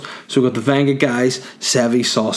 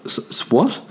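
usual speaking voice

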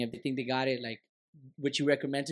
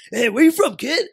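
loud, higher mock tough-guy call; cigar-guy impression; 9 semitones higher, 10 dB louder than usual. Comic retelling how the cigar guy hailed him, loud mock call. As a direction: imitate a cigar guy calling out, pitched up. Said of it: "just like you, the cigar fucking".

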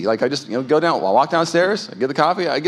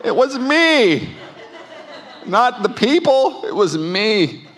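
rueful laughing confession; 6 semitones higher, much slower than usual. Real, not acted. Confessing his own failing.

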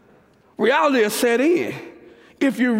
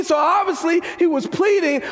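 usual speaking voice

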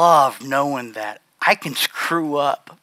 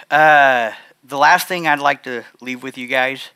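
ordinary delivery

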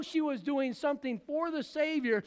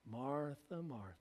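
much lower and much softer, soothing; calm, a bit patronizing; 9 semitones lower, 12 dB quieter than usual. Acted. Preacher voicing the Lord answering anxious Martha, soft and patronizing. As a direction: hush her gently like a fretting child. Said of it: "calmingly, maybe a little patronizing in his voice".

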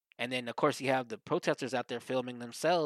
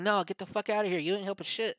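imitated angry rebuff; 7 semitones higher than usual. Acted angry rebuff of the protester.

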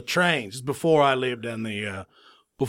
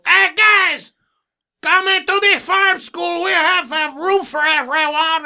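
very high, loud, drawn-out holler; hammed-up cow summoning all his buddies at full volume; calling out; 7 dB louder than usual. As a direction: shout to a herd far across the field.

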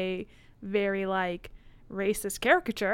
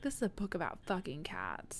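hushed whispered aside; 3 semitones lower, 12 dB quieter than usual. Real, not acted. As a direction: breathe it out under your breath.

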